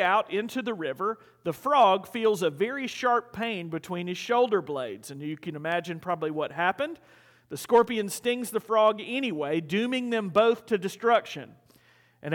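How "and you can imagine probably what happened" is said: ordinary delivery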